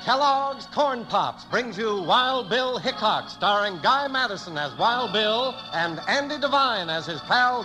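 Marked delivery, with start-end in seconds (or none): none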